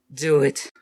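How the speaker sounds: loud, firm command; urgent demand. Acted. Rain urging Alice to strike, forcefully. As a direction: push it out hard. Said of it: "Rain demanding, urgent".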